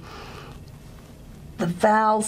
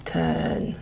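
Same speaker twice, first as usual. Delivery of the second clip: exaggerated creak; 6 dB quieter than usual. Shown as a demonstration. Teacher showing creak at the end of a turn. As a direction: turn trailing off into a creaky fade. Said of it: "I'm exaggerating there a little bit".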